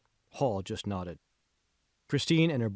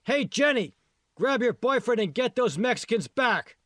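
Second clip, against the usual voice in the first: much higher, louder yell across the hill; 12 semitones higher, 5 dB louder than usual. A narrator's reading. Supervisor ordering the teen to chase the runaways, yelling. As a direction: call out loud to the girl far off. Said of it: "yelled Ozzy".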